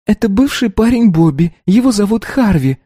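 put-on high, light girlish voice; young girl voice. A narrator's reading. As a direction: voice the earnest teenage daughter. Narrator voices the daughter.

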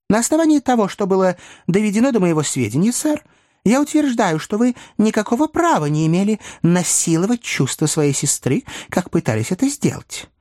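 high-pitched, emphatic rebuke; earnest remonstrance. Acted. Pickwick reproaches Ben Allen.

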